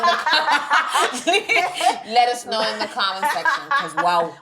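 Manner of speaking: high, loud, cracking up mid-sentence; laughing. Real, not acted. Host asks listeners about the grammar muddle, laughing. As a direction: talk through a real fit of laughter.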